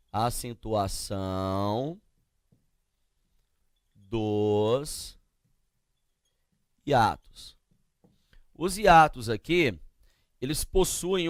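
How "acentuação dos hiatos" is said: slow dictation. Shown as a demonstration. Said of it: "a acentuação dos hiatos".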